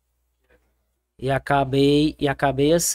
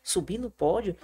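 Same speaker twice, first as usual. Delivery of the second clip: soft, high, rising question; small-voiced acted surprise; retold puzzled question; 4 semitones higher, 8 dB quieter than usual. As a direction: echo it back in mild disbelief. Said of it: "Aí eu".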